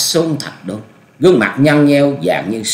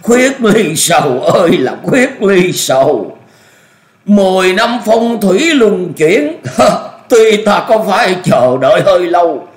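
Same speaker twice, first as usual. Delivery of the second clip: hysterical gloating laughter; 10 semitones higher, 5 dB louder than usual. A narrator's reading. Old master savouring revenge at last, cackling wildly. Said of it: "cười ngặt nghẽo nói".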